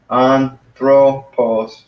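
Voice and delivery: "Anthropos" very slowly in three separate syllables. Shown as a demonstration. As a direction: sound out "Anthropos" as an-thro-pos. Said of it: "so three syllables".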